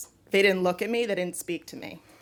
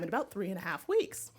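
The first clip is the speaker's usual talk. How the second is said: ordinary talk, nothing marked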